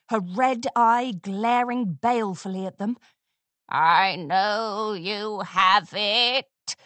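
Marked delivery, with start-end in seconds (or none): 3.7-6.8 slow, creaking crone voice; croaking old mare. A narrator's reading. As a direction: croak it out like an ancient hag. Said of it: "she croaked".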